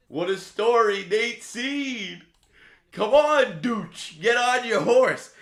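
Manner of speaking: high, loud cheering; excited shout. Real, not acted. Streamer cheering a horse in chat, shouting. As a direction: roar him down the stretch.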